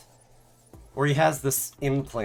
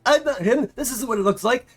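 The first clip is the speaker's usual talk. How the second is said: much higher, louder panicky denial; flustered denial; 10 semitones higher, 4 dB louder than usual. Retold or imitated. Inventor caught out denying who he is, hammed-up panic. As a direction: scramble to deny everything. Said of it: "It's like".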